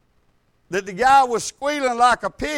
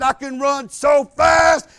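loud, slow imitation of a demon; imitated demonic boast; 5 semitones higher, 8 dB louder, slower than usual. Possessed man boasting to the preacher, loud demonic voice. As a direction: stretch it out loudly as the demon. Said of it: "in a deep demonic".

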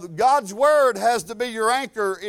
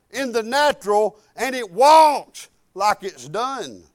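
slow, raised, punched-out proclamation; emphatic preaching; 4 semitones higher, 7 dB louder, slower than usual. Acted. Preacher relaying the Lord's lesson on faith, emphatic proclamation. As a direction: hammer it out slowly, phrase by phrase.

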